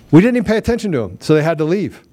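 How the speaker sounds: fast and high, rising excitement; excited. Real, not acted. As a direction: zagraj to z rosnącym podnieceniem, szybko i wysoko. Excited retelling rally.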